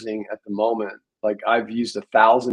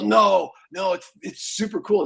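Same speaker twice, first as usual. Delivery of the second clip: imitating an eager friend, higher pitch; mildly hyped retelling of someone's enthusiasm; excited buddy; 9 semitones higher than usual. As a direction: pitch up as an excited pal insisting. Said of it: "He's all excited".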